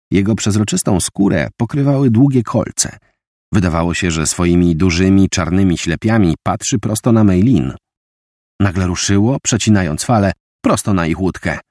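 ordinary delivery, nothing marked

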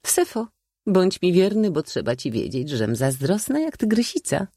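high, lively spinster with mock jealousy; coquettish old maid. A narrator's reading. Old maid warning her chosen knight to be faithful, playfully jealous.

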